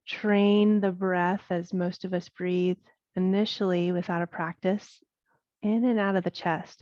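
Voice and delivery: unhurried yoga breathing guidance with long pauses; guided meditation. Acted. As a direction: guide them through the breath, leaving space between phrases. Leads guided breath practice.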